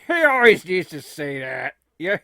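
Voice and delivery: ordinary talk, nothing marked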